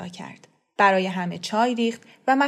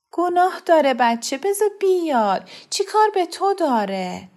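higher pleading mother's voice; 9 semitones higher, 4 dB louder than usual. A narrator's reading. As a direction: beg him to let the boy come. (مادری ملتمس با صدای زیرتر)

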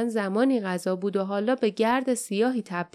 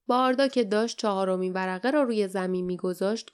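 usual speaking voice

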